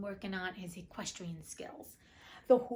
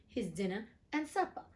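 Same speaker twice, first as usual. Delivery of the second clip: plain reading voice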